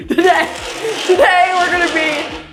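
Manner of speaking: bright, laughing teacher voice; mock class instructor. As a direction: announce it like a class leader. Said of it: "What class did you go to where your professor was like".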